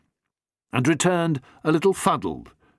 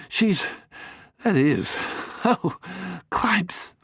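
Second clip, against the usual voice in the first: lightly voiced young man, higher and stumbling; flustered young chap; 6 semitones higher than usual. Bingo flusters when caught out, higher and halting. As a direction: fumble for words, embarrassed.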